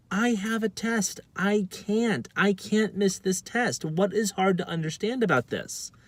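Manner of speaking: high, slow, exasperated insistence; exasperated pleading. Retold or imitated. Student explaining the test to the principal, exasperated.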